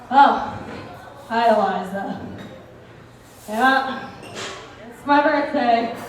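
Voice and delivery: lightly voiced dad bracing for an argument; dad answering his birthday call, warily; wary dad voice. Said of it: "in his best ... kind of voice".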